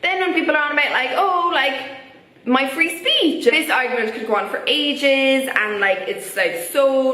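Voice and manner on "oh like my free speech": higher, put-on whine of protest; mocking imitation of a complainer; mock complaint. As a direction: imitate an aggrieved objector, pitched up. Mimics people complaining about free speech.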